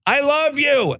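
high, ringing call of I love you; yelling I love you. Acted. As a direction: fling it out while running off a pier.

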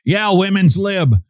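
mock a smug cable news host; Tucker Carlson. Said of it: "Thinking of Tucker Carlson again".

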